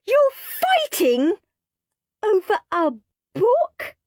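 very high, slow disbelief; incredulous. A narrator's reading. Mum finding the boys fighting over a book, incredulous. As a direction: stretch it out in amazement.

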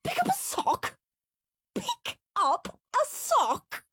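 put-on shrill, disbelieving kid echoing the order; outraged child voice. Henry aghast at Mum's order to pick up socks. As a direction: squeak it out in sheer disbelief.

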